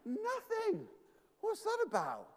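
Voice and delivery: high-pitched, incredulous squeal of complaint; mock disbelief. Pretend prophet finding Baal silent, high mock disbelief. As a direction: play baffled, voice shooting up.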